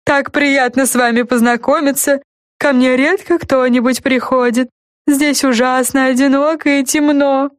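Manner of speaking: ordinary delivery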